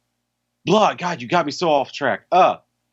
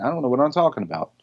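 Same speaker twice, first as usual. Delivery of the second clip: ordinary talk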